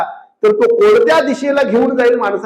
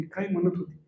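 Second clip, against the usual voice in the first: much softer, secretive asking; hushed question; 4 semitones lower, 15 dB quieter than usual. Retold or imitated. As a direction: lower the voice to pry quietly. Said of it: "हळूच म्हटली". (हळू, दबक्या आवाजात विचारणं)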